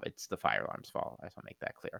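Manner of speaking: whispered, half to herself; whispered aside. Real, not acted. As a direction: whisper it like a confession. Quiet aside defending Liz.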